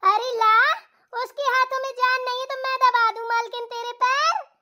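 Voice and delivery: high wailing young wife voice, hammed up; whiny wife. Young wife laments to her mother-in-law, high and wailing. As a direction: wail like a put-upon daughter-in-law.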